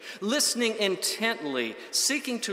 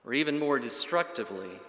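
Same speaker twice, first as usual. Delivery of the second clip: ordinary delivery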